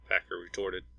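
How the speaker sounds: usual speaking voice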